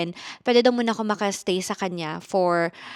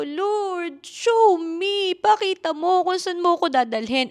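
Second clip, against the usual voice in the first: tearful prayer; 9 semitones higher, slower than usual. Retold or imitated. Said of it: "Tapos iyak ako ng iyak".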